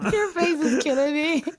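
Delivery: high, laughing through the line; nearly crying with laughter. Real, not acted. Co-host laughing at the host's face impression.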